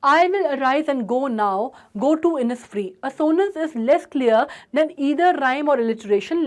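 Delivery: very slow, drawn-out "I" and "go"; stressing the vowels. Shown as a demonstration. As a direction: stretch the vowels of "arise" and "go". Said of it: "आई and ओ का बहुत ज़्यादा इस्तेमाल". ("arise" और "go" के स्वर खींचकर, बहुत धीमे)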